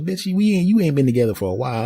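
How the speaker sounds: high, quick put-on woman's voice; sassy new girlfriend. New girlfriend telling off the ex, sassy. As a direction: cut her down with sass.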